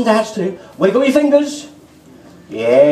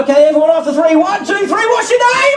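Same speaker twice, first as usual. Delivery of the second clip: high, lively crowd warm-up; rally the kids to shout; kids party magician; 10 semitones higher than usual. Acted. Crowd count-in.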